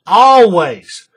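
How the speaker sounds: "always" loud and drawn out; emphatic repetition. Real, not acted. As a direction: lean hard on "always" to insist. Stresses his fixed rule.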